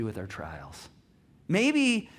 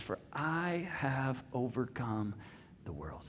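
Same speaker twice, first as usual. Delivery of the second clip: slow and much softer, trailing away; hushed solemn declaration; 4 semitones lower, 7 dB quieter, slower than usual. Retold or imitated. Pastor voicing Jesus' promise to the church, slow hushed solemn. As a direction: lay it down like a quiet promise. Jesus' five powerful words.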